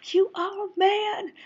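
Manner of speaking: high, loud, stretched-out proclamation; mock in-his-face yell. Woman reaffirming a man during prayer, high reenacted yell. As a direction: reenact yelling an affirmation right at him. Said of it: "screaming, and not screaming".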